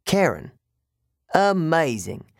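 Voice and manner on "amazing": higher, mock-impressed British-like accent; strange British accent. A narrator's reading. Guard praises the boy's repetition, strange British accent. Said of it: "He had a strange accent".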